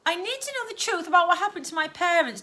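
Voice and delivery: put-on a girl pressing for the truth; urgent girl's demand. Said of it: "demanded the girl".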